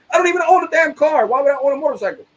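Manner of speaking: high, loud indignant rant; exasperated outburst. Real, not acted. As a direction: blow up in disbelief at the accusation. Ranting about fraud charge.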